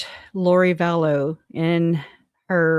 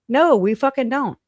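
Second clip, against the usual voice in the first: emphatic, higher-pitched objection; indignant rebuttal; 6 semitones higher, 3 dB louder than usual. Real, not acted. Rejecting Trisha's demand for emails, indignant rebuttal. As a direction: push back hard, fed up.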